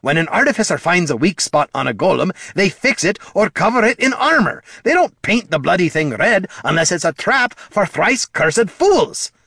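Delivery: high-pitched angry tirade, climbing to the end; snapped. A narrator's reading. Dwarf lecturing bard on golem traps, snapping. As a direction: berate him in exasperation. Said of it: "snapped Gorm".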